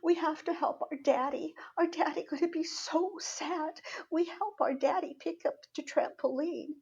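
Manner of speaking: high-pitched mock child's wail; crying little girl. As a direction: imitate a little one pleading through tears. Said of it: "the little one's crying. She's going".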